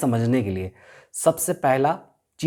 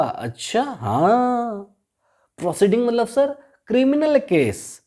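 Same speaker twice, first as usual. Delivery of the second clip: a higher student voice catching on; eager student; 6 semitones higher, slower than usual. Pretend. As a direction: answer like a pupil who just got it. (ऊँची आवाज़ में समझ गए छात्र का जवाब)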